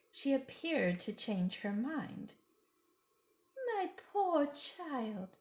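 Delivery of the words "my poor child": high, slow coaxing witch voice; suddenly sweet Queen, drawn out slowly; a lightly voiced false kindness; a different, gentler voice. A narrator's reading. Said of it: "she said in a quite different voice".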